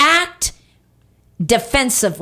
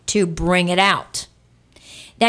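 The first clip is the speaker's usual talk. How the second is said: usual speaking voice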